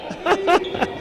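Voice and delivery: short high laugh. Real, not acted. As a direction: laugh it off, a bit sheepish. Joke about playing song on phone.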